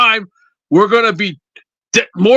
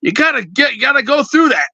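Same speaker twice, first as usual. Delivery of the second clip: normal talking voice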